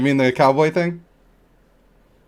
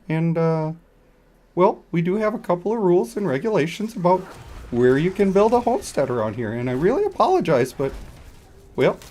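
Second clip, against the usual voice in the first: put-on higher, softer, overly polite Canadian accent; 5 semitones higher, 3 dB quieter than usual. Streamer doing a polite Canadian neighbor, Canadian accent. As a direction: apologize in a Canadian accent while scolding. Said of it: "I'll do Canadian".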